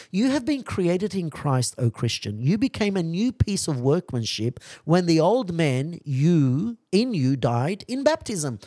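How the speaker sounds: high, loud preaching read; fervent preaching. Real, not acted. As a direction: proclaim the quotation to the congregation. Reads the Father's text with fervour.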